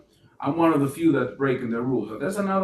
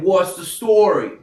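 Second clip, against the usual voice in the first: slow, louder New York accent; 4 semitones higher, 6 dB louder, slower than usual. Shown as a demonstration. New York accent for viewers asking what the pitch is. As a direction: drawl it like a New Yorker. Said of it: "with an accent".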